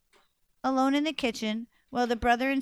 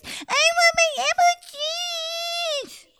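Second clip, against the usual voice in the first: put-on whiny baby. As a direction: whine it like a toddler. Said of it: "Everything the baby said was a whine".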